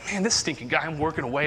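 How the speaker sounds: ordinary talk, nothing marked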